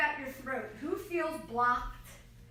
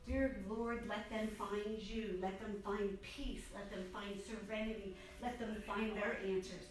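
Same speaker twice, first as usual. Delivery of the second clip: quiet prayer; 2 semitones lower, 4 dB quieter than usual. Retold or imitated. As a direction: pray it gently, letting each line settle. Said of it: "all you could do is pray".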